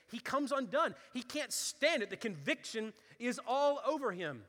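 rising sermon fervour; fiery preaching. Acted. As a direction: preach it hard to the congregation. Preaching at full force.

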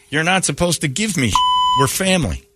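high, stressed FAMILY; pointed comeback. Acted. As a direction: throw the line back at them. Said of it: "And my argument back is".

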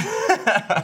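high laugh. Real, not acted. Laugh at puzzle.

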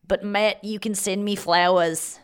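slow, put-on Australian; Australian accent. Telling Matt to send flowers, mock Australian accent. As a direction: lean into a badly faked Australian accent. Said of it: "I got Australian on that".

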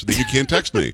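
normal talking voice

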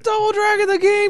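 high, loud fake hype; mock hype. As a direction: announce it like a thrilled fan.

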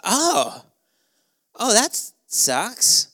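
high, slow, drawn-out fake concern; a hammed-up sympathetic oh; mock sympathy. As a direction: pretend to feel sorry while doubting. Retold fake sympathy to date.

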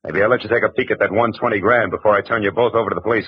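ordinary talk

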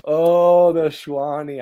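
high, loud delighted echo. Real, not acted. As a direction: savour the nickname with glee. Echoing nickname delighted.

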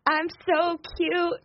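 high-pitched show-off, no real volume; mock show-off yelling. Girl re-enacting her twirling in the store, mock yelling. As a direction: call it high and drawn out at the end. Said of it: "yelling at the top of her lungs for everyone".

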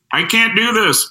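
high-pitched mocking call; mocking heckler. Heckler mimics him from the back of the room, high mocking call. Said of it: "someone in the back of the room would go".

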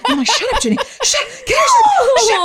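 hammed-up squealing panic, very high and loud; frantic lunatic. Storyteller mimics scolding herself mid-proposal, hammed-up lunatic shriek. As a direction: act out losing it, stammering and shouting. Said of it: "like a fucking lunatic".

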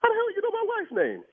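sharp high disbelief; stunned husband hearing his wife named; shocked. Real, not acted. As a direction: blurt it out in shock. Victim startled by wife's name.

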